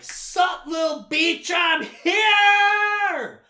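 high-pitched, shouted house-elf entrance; Dobby. Retold or imitated. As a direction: burst in with a loud, drawn-out squeal. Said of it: "Dobby rolls up like".

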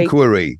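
"Inquiry" stressed up front, as IN-kwuh-ree; American pronunciation. Shown as a demonstration. Correcting a co-host on how they say it, American pronunciation. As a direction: say "Inquiry" as INK-wuh-ree. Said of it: "that's how they say it".

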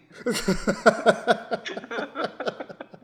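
big high cackling laugh, real; loud burst of laughter. Real, not acted. Host cracking up at a ridiculous move name, loud laugh.

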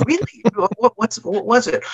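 high, excited, laughing questions; eager birder. Retold or imitated. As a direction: play a thrilled birder asking. Birder eagerly asking in analogy.

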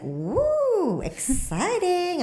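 high, slow excited whoop; mock spirit delighting in fear; gleeful ghost. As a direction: voice the ghost thrilled to play. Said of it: "he's like".

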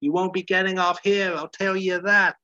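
a touch of a scornful hooker; mock prostitute. Prostitute brushing off Larry, lightly voiced. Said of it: "the prostitute's like".

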